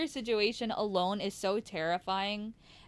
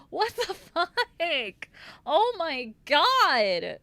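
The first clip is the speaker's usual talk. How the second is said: slow, laughing, higher; laughing disbelief; 6 semitones higher, much slower than usual. Real, not acted. After ranting at the fiancé, slow laughing disbelief. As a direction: drag it out in disbelief.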